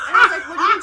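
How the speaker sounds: loud, high laughing shout. Real, not acted. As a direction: blurt it out through laughter. Laughing retelling mom's remark.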